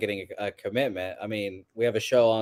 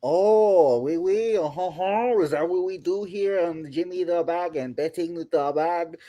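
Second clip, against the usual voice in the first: higher, put-on French Canadian accent; 4 semitones higher, slower than usual. Shown as a demonstration. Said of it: "about to do a French Canadian accent".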